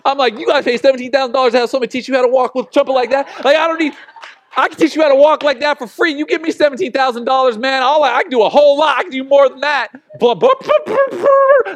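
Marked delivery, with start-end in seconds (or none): 0.4-9.9 higher, louder, fast exasperated rant; incredulous rant. Acted. Ranting about band camp bill. 10.2-11.7 very high, loud dismissive blah-blah; mocking blah blah. Mocking the explanation.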